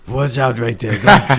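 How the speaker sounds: put-on low stern warning to kids; lightly voiced gruff dad; G-Stats voice. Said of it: "I wish I had G-Stat's voice".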